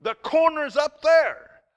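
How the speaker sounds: high, loud, drawn-out retort; acted-out shout of frustration, slow and emphatic; exasperated yell. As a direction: yell back at a panicking passenger. Yelling back at panicking passenger.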